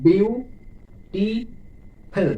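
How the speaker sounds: "Beautiful" as বিউ ... টি ... ফুল, very slow; syllable by syllable. Shown as a demonstration. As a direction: start high and rising, three separate beats in beautiful. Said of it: "তিনটি".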